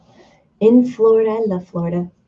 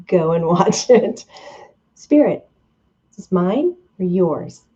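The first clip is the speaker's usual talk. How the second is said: usual speaking voice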